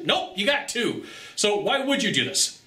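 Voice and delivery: ordinary delivery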